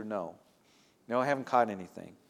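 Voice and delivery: quiet, fast sheepish mumble; embarrassed answer. Retold or imitated. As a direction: admit it quickly and under your breath. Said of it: "nothing more embarrassing".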